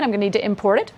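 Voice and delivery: usual speaking voice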